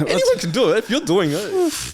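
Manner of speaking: high, laughing squeal through the words; laughing at the excuse, a high giggling squeal; laughing disbelief. Real, not acted. Repeats the point laughing.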